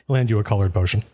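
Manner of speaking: ordinary delivery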